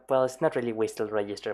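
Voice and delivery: ordinary delivery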